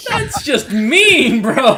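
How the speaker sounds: high, loud, through laughter; cracking up while protesting, drawn out; helpless laughing outburst. Real, not acted. Laughing at player's joke.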